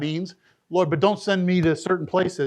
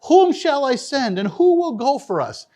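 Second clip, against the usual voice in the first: higher ringing call of the Lord; raised divine call; 6 semitones higher, 4 dB louder than usual. Acted. Preacher voicing God's call again, higher and ringing. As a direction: call out as God seeking a messenger.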